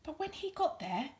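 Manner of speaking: plain reading voice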